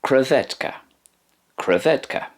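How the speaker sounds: "krewetka" said kre-VET-ka; teacher models "krewetka"; Polish word modelled. Shown as a demonstration. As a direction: repeat "krewetka" twice. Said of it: "Polish word for shrimp is".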